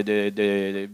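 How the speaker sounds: usual speaking voice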